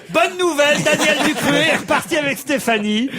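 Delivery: upbeat TV news anchor voice; cheerful news opener; news anchor impression. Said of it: "ouvrir le trésor en disant".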